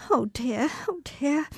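soft, distressed little moan; pained murmur. Acted. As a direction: mutter the pain away. Pained aside mid-speech.

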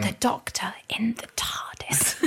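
soft, slow whisper. Real, not acted. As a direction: breathe it as a whisper. Whispered line.

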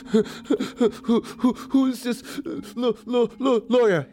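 high, slow, sobbing stammer; wailing high stutter; crying and stammering. Retold or imitated. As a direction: gulp the words through tears. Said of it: "make me stop crying".

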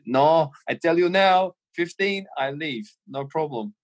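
imitating Gravesen's Scouse German accent. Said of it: "he's got this weird Scouse German accent going on".

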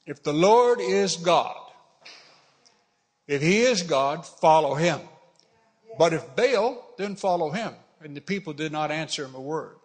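emphatic, deliberate pulpit challenge; scripture reading. Acted. Elijah challenging the people to choose, emphatic scripture reading. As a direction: lean on each condition, then pause.

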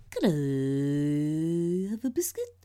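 very slow stretched-out question; milk every word slowly; weird drawn-out delivery. Retold or imitated. Imitating the friend ruining the take, slow and strange. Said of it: "he just went".

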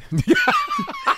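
high laugh. Real, not acted. Laughs at own joke.

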